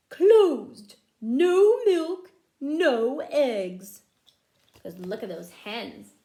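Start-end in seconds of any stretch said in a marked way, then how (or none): 0.1-4.0 much louder, higher shouted cow voice; defiant strike sign. Acted. The striking cows' new sign, loudly proclaimed. They left a new note.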